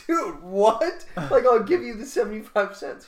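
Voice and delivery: loud, high, incredulous protest; outraged disbelief. Retold or imitated. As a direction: demand a fair price, loudly exasperated. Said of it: "I was like".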